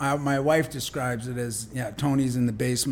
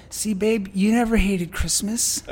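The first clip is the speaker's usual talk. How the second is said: higher, lightly voiced wife, gently knowing; imitating wife; 8 semitones higher, 6 dB louder than usual. Guest quoting his wife at The Grinch, higher and louder, tender. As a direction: play the wife, gently pointing out the truth. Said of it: "My wife looks over and she goes".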